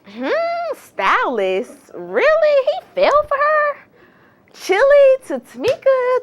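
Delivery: high-pitched, incredulous questioning; skeptical disbelief. Retold or imitated. Vlogger recalls doubting that he fell for her, skeptical disbelief. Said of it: "I just was like".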